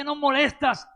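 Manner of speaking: acted irritated rebuke from the crowd; crowd's acted scolding. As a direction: scold him as a nuisance. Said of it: "qué le decía la gente".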